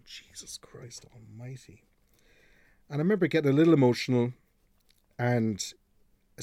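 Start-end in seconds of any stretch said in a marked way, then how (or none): 0.1-1.8 slow, breathless whisper of disbelief; hushed slow whisper, overwhelmed; awed whisper. Real, not acted. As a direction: breathe it out slowly, stunned. Moved by listener's message, aside.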